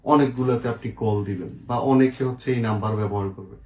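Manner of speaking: ordinary delivery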